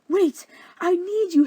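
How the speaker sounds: high, loud, drawn-out call; desperate plea. Acted. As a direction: beg after someone leaving, hammed up. Suitors beg Helena to stay.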